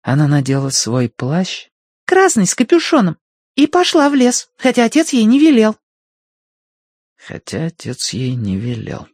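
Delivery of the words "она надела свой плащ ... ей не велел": high, lively fairy tale telling; storytelling voice. A narrator's reading. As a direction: tell it like a bedtime story.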